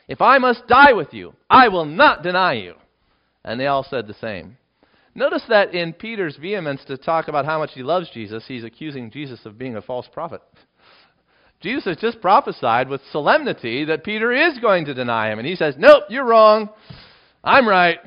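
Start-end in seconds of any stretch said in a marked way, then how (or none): 0.1-2.8 loud, high emphatic protest; emphatic vow. Acted. Peter emphatically answering Jesus, loud vow. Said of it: "he said emphatically". 15.8-18.0 loud, high dismissive retort; defiant retort. Acted. Peter telling Jesus he is wrong, loud and defiant.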